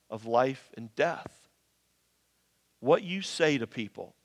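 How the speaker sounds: soft, low, earnest pleading; quiet preaching. Real, not acted. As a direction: drop to a hush and plead. Quiet earnest stretch of sermon.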